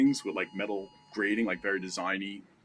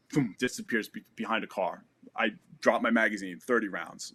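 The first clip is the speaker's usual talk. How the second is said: usual speaking voice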